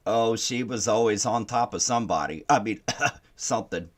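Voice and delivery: ordinary talk, nothing marked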